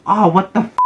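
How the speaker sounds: startled yell, high and loud; shocked loud outburst. Acted. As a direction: blurt it out in shock at the blood. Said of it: "can't help but yell".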